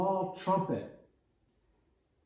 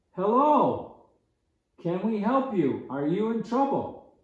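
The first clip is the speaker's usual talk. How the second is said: higher, louder call across the woods; 6 semitones higher, 5 dB louder than usual. Retold or imitated. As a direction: hail a stranger from a distance. Campers calling out to stranger.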